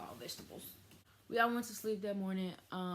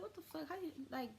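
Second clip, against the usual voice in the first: retold indignation; 10 semitones higher, 5 dB quieter than usual. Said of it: "I was mad than a bitch".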